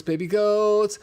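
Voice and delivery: excited squeal. Real, not acted. Cooing over goat video.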